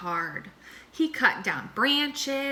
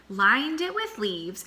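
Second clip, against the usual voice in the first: usual speaking voice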